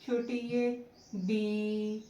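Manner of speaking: drawn-out teaching example of बे; slow demo of बे for the class; slow demonstration. Shown as a demonstration. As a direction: say बे slowly and clearly, then छोटी ये. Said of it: "जैसे".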